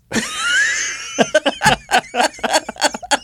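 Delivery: a very high shrieking burst of laughter; squealing laugh. Real, not acted. Cohost cracks up at his own joke. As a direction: let a shrill laugh fly out.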